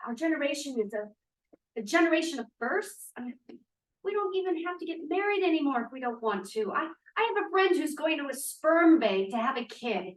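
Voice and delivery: normal talking voice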